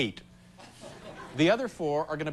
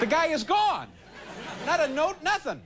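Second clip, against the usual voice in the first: mock outrage; 10 semitones higher than usual. Comedic outrage at vanished vendor.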